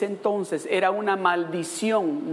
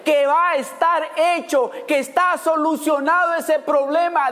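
fervent preaching; 6 semitones higher than usual. Acted. Preacher urging the congregation, fervent and building. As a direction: preach it to the room with rising fire.

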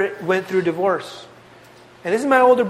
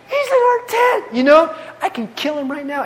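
mock David voice; 9 semitones higher than usual. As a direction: play David eager and sly, half-joking. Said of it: "and David's like".

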